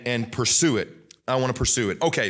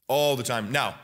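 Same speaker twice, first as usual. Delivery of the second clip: ordinary talk, nothing marked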